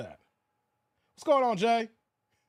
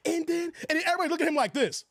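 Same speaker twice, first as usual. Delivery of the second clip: normal talking voice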